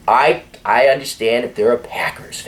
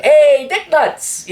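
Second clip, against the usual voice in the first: higher, slow drawn-out friendly shout; a guy hollering a friendly insult at his buddy; mock yell; 4 dB louder, much slower than usual. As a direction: bellow a buddy's insult across the room. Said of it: "someone yelling at him".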